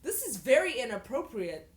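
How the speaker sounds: scandalised. Acted. Reader objecting to the story, scandalised. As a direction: exclaim it in mock outrage.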